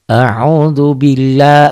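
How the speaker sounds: slow drawn recitation, la of billah held long. Shown as a demonstration. Teacher showing students the long la, slow and drawn. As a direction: stretch the la in billah out. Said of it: "la-nya jadi panjang".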